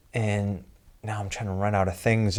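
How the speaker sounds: usual speaking voice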